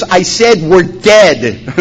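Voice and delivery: slow, drawn-out yelled comeback; retold yelling. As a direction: stretch out an exasperated mock yell. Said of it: "start yelling".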